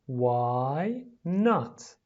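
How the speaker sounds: two stretched words with a gap between; slow, word by word. Shown as a demonstration. Teacher pronouncing for pupils at home, word by word. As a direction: draw out each word separately.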